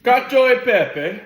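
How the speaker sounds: put-on loud, slow, high Italian accent. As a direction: belt it like an opera Italian accent. Hammy Italian dish announcement.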